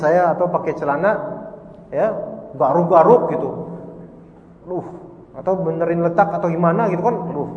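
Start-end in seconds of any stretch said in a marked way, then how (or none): none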